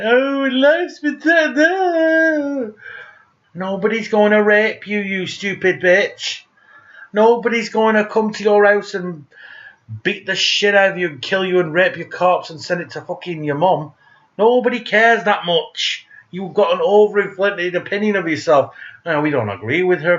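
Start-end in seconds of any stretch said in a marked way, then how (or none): 0.0-2.7 high, very slow mock cry; mock whine. Mocking Anita Sarkeesian, mock wailing. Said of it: "Anita Sarkeesian saying". 3.5-18.7 scornful rant. Acted. Ranting at a critic.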